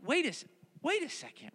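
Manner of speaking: high, startled wait; double take. Retold or imitated. Listener catching the lion detail, startled. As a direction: stop short in disbelief. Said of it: "you go like".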